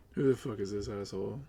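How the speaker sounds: ordinary delivery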